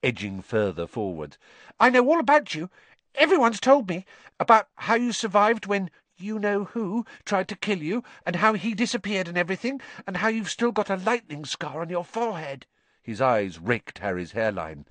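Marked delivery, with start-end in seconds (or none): 1.8-12.7 high, eager schoolboy gushing; Colin Creevey. A narrator's reading. Colin telling Harry he knows all about him, eagerly. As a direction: gush like a starstruck little fan. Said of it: "said Colin Creevey eagerly".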